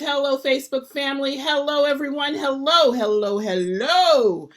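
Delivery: high, loud hellos, stretching out the last one; big, ringing show-opening welcome; exuberant greeting. Real, not acted. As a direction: greet the whole crowd with gusto. Host calls out opening livestream greeting.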